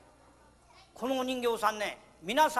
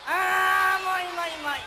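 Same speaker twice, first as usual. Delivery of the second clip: mock exasperated; 7 semitones higher, 4 dB louder than usual. Stops the puppet's bowing.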